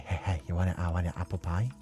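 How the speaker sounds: ordinary talk, nothing marked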